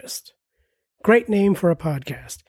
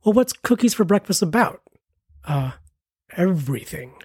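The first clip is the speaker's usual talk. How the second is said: usual speaking voice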